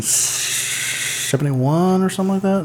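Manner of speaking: a hint of a Scottish Connery drawl on the years; Sean Connery impression. Mid-story about Connery's films, a light mock Connery Scottish accent. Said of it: "You had to say seventy-one like that? Like he would?".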